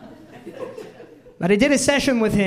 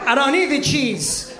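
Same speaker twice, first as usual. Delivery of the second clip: whiny protest; 5 semitones higher than usual. Retold or imitated. Whining at the trainer.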